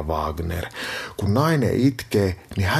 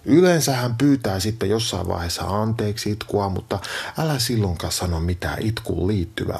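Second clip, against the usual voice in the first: ordinary talk, nothing marked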